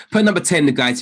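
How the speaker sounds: high, loud call to the chat; rally the viewers with a loud shout. Real, not acted. Calling out to the audience.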